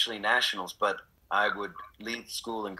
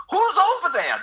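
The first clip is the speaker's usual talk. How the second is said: much higher, louder sudden new character; third character; 7 dB louder than usual. Acted. A third character in a one-man play calling out, high and loud. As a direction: pop in as a new figure calling out. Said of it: "You can have, like, three".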